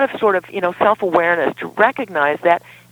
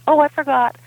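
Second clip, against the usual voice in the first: higher-pitched, feigned innocent forgetfulness; mock passive-aggressive; 7 semitones higher than usual. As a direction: imitate a partner's passive-aggressive excuse. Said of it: "kind of passive aggressive by just".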